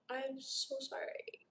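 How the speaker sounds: usual speaking voice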